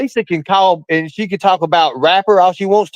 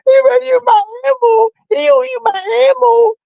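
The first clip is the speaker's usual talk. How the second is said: squealing mock sob, much higher; mocking crying. Mocking Katie crying on stream, high mock sob. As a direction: whine it like a bawling brat. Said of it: "when you get mad and you cry".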